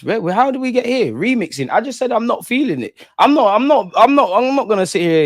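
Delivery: high, exasperated protest; frustrated streamer ranting; heated rant. Real, not acted. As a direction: push back hard at the chat.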